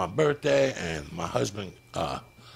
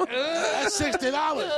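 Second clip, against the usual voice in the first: high mock husband; 3 dB louder, slower than usual. Reenacts husband.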